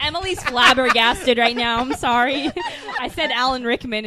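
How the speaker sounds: talking through laughter; loud, breaking up with laughs; laughing explanation. Real, not acted. Host explaining friend's meltdown over the actor, laughing.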